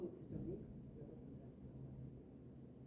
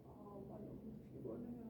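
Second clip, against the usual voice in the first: put-on quiet, worn-out child voice; soft, weary retold complaint to mom; tired child; 11 dB quieter than usual. Daughter back from band practice telling mom, wearily.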